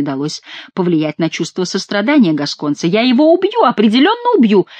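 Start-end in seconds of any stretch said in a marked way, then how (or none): 2.9-4.7 high-pitched insistent lament; plaintive cry. A narrator's reading. Monk warns he will kill his donkey, plaintive cry. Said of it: "жалобно кричал монах".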